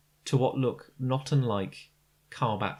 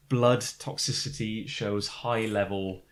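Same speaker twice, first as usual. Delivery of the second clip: ordinary delivery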